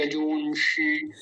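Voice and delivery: यजूंषि slowly, with a drawn-out ऊ; slow यजूंषि, held ऊ; long ऊ. Shown as a demonstration. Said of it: "थोड़ा लंबा खींचेंगे".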